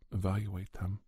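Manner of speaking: ordinary delivery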